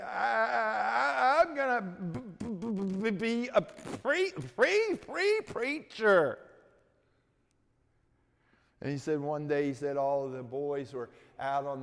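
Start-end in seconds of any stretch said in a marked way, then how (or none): 0.0-6.4 high, loud stammer catching on every sound; stuttering. Retold or imitated. A mock stutter for the preacher boy telling his dream. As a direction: push out each broken syllable, high and strained.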